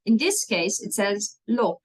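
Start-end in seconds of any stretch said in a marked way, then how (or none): 1.5-1.8 "lop" cut short with a short O; quick short vowel in "lop". Shown as a demonstration. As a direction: say "lop" with a brief, open O. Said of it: "That's not quite the same".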